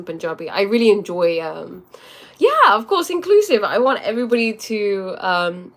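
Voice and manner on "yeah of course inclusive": eager agreement. Real, not acted. Emphatic agreement.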